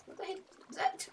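soft, high cracked question. Real, not acted. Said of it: "horrible voice crack".

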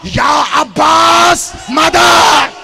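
loud, high, drawn-out cry for help; calling out. Acted. Ali calls his son Abbas for help, loud high drawn-out cry. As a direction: Ali calling across the battlefield for Abbas, slowly. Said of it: "उस अली ने कहा".

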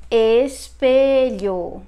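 "Espelho" drawn out slowly with both e's closed. Shown as a demonstration. Teacher introducing the word for mirror, slow closed-e "Espelho". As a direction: stretch "Espelho" syllable by syllable, loud, closed e. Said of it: "The both letter E is going to be closed".

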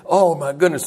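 normal talking voice

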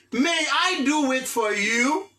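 slow, drawn-out English question; slow model phrase. Shown as a demonstration. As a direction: stretch it for learners. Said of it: "teacher repeats model phrase slowly". (kesyon anglè dousman)

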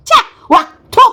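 loud retch and spit noises; hammed-up "yuck" ending in a spit; disgusted spitting. Host disgusted at the mispronunciations, spitting in mock revulsion.

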